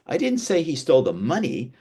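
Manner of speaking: "money" emphasised for contrast; stress on MONEY. Shown as a demonstration. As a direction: lean on "money" at the end. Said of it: "changes the meaning".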